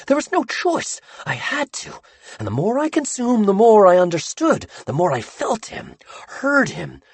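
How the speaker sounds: high, feverish voice rising and sinking; a madman recalling his possession with awe; obsessed Sammy confessing. Acted. Sammy telling the bound boys how the ink found him, feverish.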